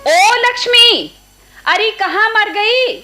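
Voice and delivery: loud, slow call to the niece in another room; aunt shouting across the house for Lakshmi. Acted. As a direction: holler for Lakshmi, drawn out and impatient.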